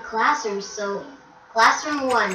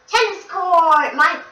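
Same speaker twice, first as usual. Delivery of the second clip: excited announcing; 7 semitones higher, 8 dB louder than usual. Pretend. Announcing rooms on a game tour.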